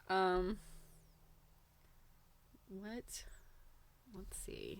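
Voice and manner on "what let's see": soft whispered self-talk while searching; breathy whisper, under the breath; whispered aside. Real, not acted. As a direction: whisper it to yourself. Muttering to self, searching page.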